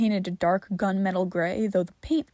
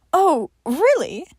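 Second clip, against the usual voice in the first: higher, slow curious "Oh, really"; interested; 7 semitones higher, 4 dB louder, slower than usual. Acted. John curious about Johnny's education, higher and slow. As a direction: perk up, genuinely curious. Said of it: "he sounded almost interested".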